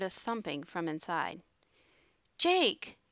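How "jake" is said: ordinary delivery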